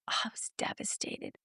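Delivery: hushed whispered aside. Retold or imitated. To the host about the job refusal, whispered. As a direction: drop to a breathy whisper.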